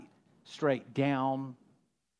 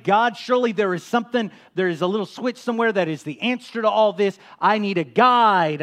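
loud, raised-pitch plea to God; urgent pleading prayer; 5 semitones higher, 8 dB louder than usual. Acted. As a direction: plead loudly, stretching the last request. Acting out a desperate prayer.

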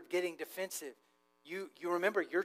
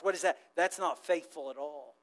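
usual speaking voice

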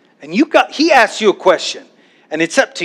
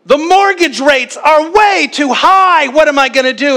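mock panicked shout; 9 semitones higher, 3 dB louder than usual. Preacher mocks a bird fretting over mortgage rates, hammed-up shout. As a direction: ham up a bird in a money panic. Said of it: "How about".

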